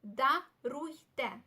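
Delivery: "Даруйте" very slowly split into да-руй-те; slow syllable-by-syllable demo. Shown as a demonstration. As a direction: sound out "Даруйте" with gaps between syllables. Teacher breaks new word into syllables.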